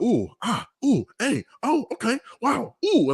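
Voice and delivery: imitating being dazzled by a performance; hammed-up wow reactions; impressed gasps. Retelling his awed reaction to dance.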